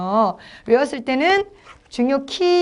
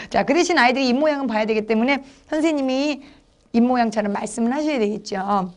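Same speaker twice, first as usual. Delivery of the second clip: usual speaking voice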